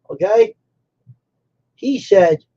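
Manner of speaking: normal talking voice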